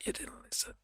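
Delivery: a spooky whispered taunt; mock whisper. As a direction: breathe it out as an eerie whisper.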